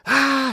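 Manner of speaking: sudden high, loud shriek; panicked scream. Retold or imitated. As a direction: gasp out a shrill-pitched scream of panic. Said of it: "She starts having a panic attack".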